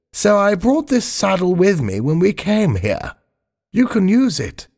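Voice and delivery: put-on high, genteel old lady voice; the Queen. The Queen offering her saddle to Spiggy, high genteel voice.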